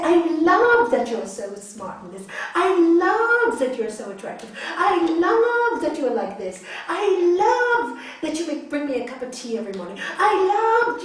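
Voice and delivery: shower the partner with warm compliments; mildly gushing with a laugh; loving compliments. Shown as a demonstration.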